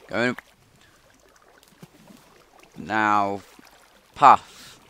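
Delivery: loud, sharp call to a pet. Real, not acted. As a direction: shout his name across the cage. Calling out to his pet.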